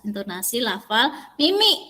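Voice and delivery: usual speaking voice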